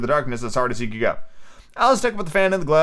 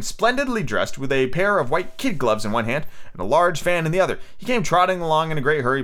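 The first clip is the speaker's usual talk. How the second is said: ordinary delivery, nothing marked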